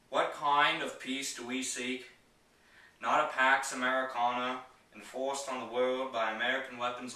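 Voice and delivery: JFK impersonation. Acted. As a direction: give a famous speech in an imitated statesman's voice, loud and emphatic, pausing between phrases. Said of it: "going to do my J F K impersonation".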